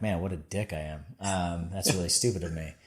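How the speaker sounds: quiet embarrassed self-dig; sheepish self-mockery. Real, not acted. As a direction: mutter it as a rueful admission. Mocking himself after interviewer's retelling.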